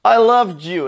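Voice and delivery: imitated anguished yell, high; hammed-up high movie yell; Obi-Wan. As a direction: cry it out as a mock-dramatic shout. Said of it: "Obi-Wan yells".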